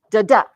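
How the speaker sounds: second "da" hit harder in "Da, da"; light first da, punched second da; unstressed then stressed. Shown as a demonstration. Teacher demonstrating one iambic foot, stress on the second beat. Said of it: "This means you do hit it".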